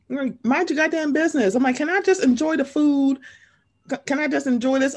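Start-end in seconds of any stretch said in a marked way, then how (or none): 0.4-1.5 higher, sharper mock telling-off; raised snap. Imagined snap. 1.8-5.0 exasperated plea. Retold or imitated.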